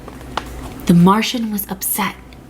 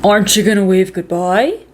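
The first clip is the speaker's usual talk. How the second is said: dad voice; 4 dB louder than usual. A narrator's reading. Dad speaks to Stanley.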